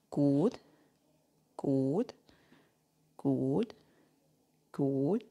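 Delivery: low, soft good with a rising lilt; calm steady encouragement; soft low praise. Shown as a demonstration. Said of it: "Good means duration".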